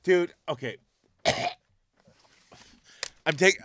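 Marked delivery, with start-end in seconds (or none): none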